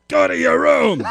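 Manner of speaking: loud, high-pitched angry shout; explosive angry command; angry yell. Retold or imitated. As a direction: yell it like a furious parent ordering a child. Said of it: "a scene where I'm really angry".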